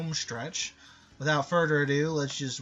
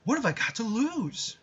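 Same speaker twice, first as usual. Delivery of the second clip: hero voice; 6 semitones higher than usual. Pretend. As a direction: pump it up like a shonen lead. Jaden finds card.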